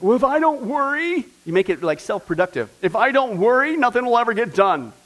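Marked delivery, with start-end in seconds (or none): 0.0-1.3 higher, louder fretting mom voice; imitating a worried mom, pitched up and loud; worrywart mom. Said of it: "My mom was always a worrywart". 2.8-4.9 higher, louder fretting mom voice; imitating a worried mom, pitched up and loud; worrywart mom. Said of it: "My mom was always a worrywart".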